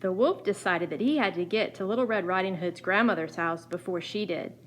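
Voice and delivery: ordinary delivery, nothing marked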